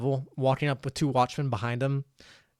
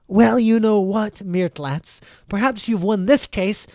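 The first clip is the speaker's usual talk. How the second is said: raised, scornful concession; full of contempt; 7 semitones higher, 6 dB louder, slower than usual. Acted. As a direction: drag out the opening with disdain, pitch up. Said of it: "person just full of contempt".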